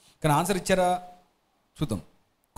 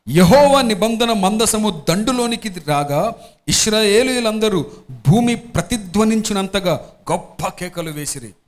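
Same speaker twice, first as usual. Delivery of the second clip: slow, louder, grand reading of scripture; 5 dB louder, slower than usual. Acted. Pastor reading the great shout passage, slow and loud. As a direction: read it big and slow, like the earth shaking.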